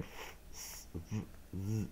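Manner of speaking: f, s, v, z as bare consonant sounds; letter sounds. Shown as a demonstration. Teacher showing how silent-E endings are read, isolated sounds f, s, v, z. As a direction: sound out f, s, v, z one at a time. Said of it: "所以我们就念".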